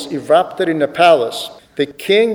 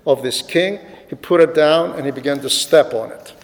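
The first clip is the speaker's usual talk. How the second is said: ordinary delivery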